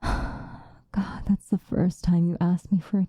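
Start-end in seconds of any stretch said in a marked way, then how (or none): 0.0-1.2 soft, breathy exhalation; overwhelmed sigh. Acted. Vampire asked for a first kiss, breathlessly moved. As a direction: sigh the word out softly, moved.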